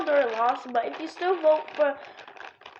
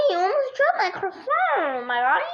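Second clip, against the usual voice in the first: cringy voice; 5 semitones higher than usual. Pretend. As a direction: ham up a silly dude. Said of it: "my cringy voice".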